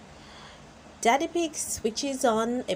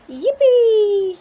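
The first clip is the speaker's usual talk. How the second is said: high, loud cheer; delighted little-girl yippee; Peppa cheering; 13 semitones higher, 12 dB louder than usual. A narrator's reading. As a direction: squeal with joy like a little girl. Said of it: "says Peppa".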